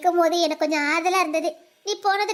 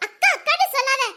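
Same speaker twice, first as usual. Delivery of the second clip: laughing; 6 semitones higher, 4 dB louder than usual. Acted. Laughing scolding of her friend.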